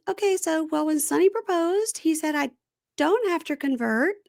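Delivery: high, girlish imitation of her apology; squeaky put-on voice of a nervous girlfriend; mimicking girlfriend. Retelling to co-host, imitating the girlfriend in a high put-on voice.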